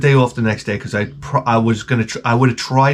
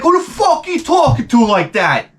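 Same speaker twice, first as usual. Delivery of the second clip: mock angry husband; 12 semitones higher than usual. Husband yells back.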